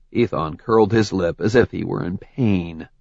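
ordinary delivery

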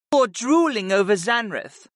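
high-pitched murmured jab; murmuring succubus. A narrator's reading. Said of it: "Viopa murmured".

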